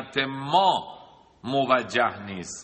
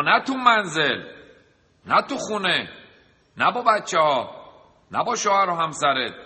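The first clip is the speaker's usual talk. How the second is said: emphatic preaching; 5 semitones higher, 5 dB louder than usual. Real, not acted. Preacher hammers each "not.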